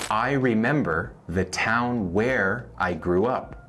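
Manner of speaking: slowed, careful model sentence; slow teaching read. Shown as a demonstration. Teacher to Spanish learners, slow model sentence. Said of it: "veamos dos ejemplos más".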